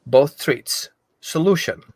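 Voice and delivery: ordinary delivery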